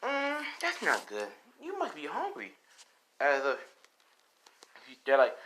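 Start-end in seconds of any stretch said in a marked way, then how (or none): 0.0-2.5 sweet and fake; a falsely caring host; Rose's sweet voice. Rose voiced in retold story.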